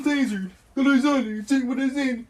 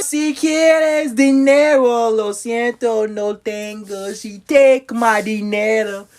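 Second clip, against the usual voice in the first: Spanish; 6 semitones lower than usual. Pretend. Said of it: "y'all like that Spanish".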